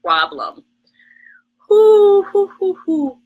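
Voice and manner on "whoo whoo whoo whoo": loud high whoos fading down; blowing out breath. Real, not acted. Vlogger calming herself on live, loud high whoos fading. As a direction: blow out the stress in bursts. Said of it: "I'm sweating in places I should not be sweating".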